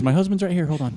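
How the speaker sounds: high, loud cheerful wife; woman's voice. Retold or imitated. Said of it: "Until she says".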